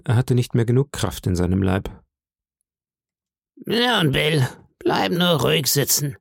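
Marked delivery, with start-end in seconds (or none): 3.6-6.2 high, slow, sing-song whine of a blind beggar; eerie sing-song beggar. A narrator's reading. Blind beggar begging the innkeeper's boy, high sing-song voice. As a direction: lilt each phrase upward like a pitiful plea. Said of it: "sagte der Bettler".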